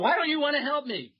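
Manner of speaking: hammed-up helpless caller; whiny caller. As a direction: whine it like a wronged patient. Caller repeats whine much higher.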